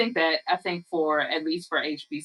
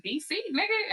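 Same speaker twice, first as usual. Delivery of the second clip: 1 proud DC dude; 14 semitones higher than usual. Retold or imitated. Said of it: "And he was like".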